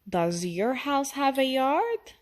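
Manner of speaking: usual speaking voice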